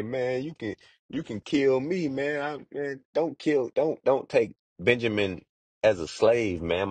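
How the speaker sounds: high, desperate begging for mercy; pleading. Retold or imitated. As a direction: beg like your life depends on it, voice up high. Judah begs for Benjamin's life.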